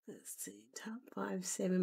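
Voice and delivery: unvoiced whisper while typing; absent-minded whispered self-talk. Real, not acted. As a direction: whisper the words as you type them.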